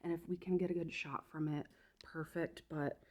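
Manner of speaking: ordinary delivery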